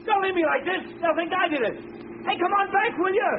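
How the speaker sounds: desperate high cries after the departing car; abandoned man calling frantically after the gang; panicked calling. Acted. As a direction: shout for them to come back in panic.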